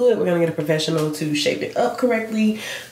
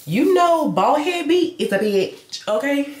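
sassy high call-out; 8 semitones higher than usual. Real, not acted. Sassy line to viewers.

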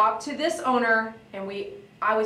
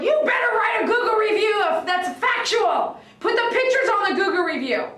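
retold yell; 10 semitones higher, 4 dB louder than usual. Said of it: "And I was like".

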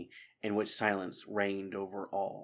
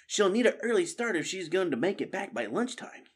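put-on higher young mouse voice, light worry; Matthias hoping; 9 semitones higher than usual. Matthias worrying about Mrs Squirrel to friends, lightly voiced. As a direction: wonder aloud hopefully.

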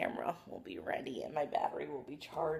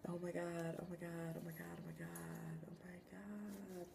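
hushed, repeated in awe; soft reverent chant of oh my God; awed whisper; 3 semitones higher, 14 dB quieter than usual. Real, not acted. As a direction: whisper it, barely believing. Awed repeated whisper over camera.

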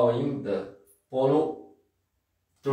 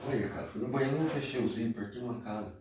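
acted scene; 8 dB quieter, faster than usual. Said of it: "tô igual um ator da Globo aqui".

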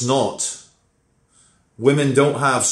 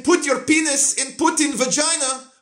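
high put-on Russian accent, Putin's pitch; Putin's line in a hammed-up Russian accent; mock Russian accent; 14 semitones higher than usual. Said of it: "Instead of saying".

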